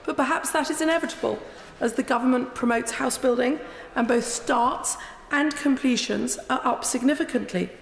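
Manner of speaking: ordinary delivery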